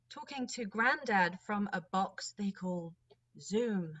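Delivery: lilting verse performance with pauses between lines; expressive spoken-word poem, measured and rhythmic; rhymed monologue reading. Acted. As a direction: read it as a wistful poem, line by line.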